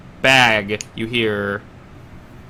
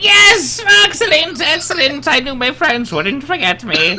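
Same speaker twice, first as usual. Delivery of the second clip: very high, loud delight; overjoyed shout; 13 semitones higher, 5 dB louder than usual. Acted. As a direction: cheer loudly in a high voice.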